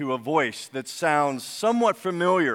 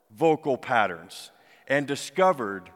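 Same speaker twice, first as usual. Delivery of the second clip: usual speaking voice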